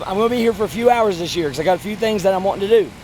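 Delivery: normal talking voice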